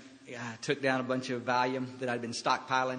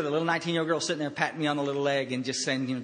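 ordinary talk

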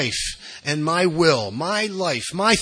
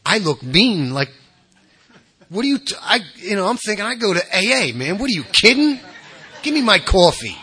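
irritable incredulous retort, rising; mock outrage at being called mean; snapping at someone; 5 semitones higher than usual. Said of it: "I'd be like".

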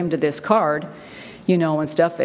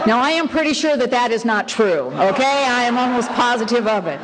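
higher voice, laughter spilling into the words; still laughing; 6 semitones higher than usual. Real, not acted. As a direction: talk through leftover laughter. Laughing after the joke lands.

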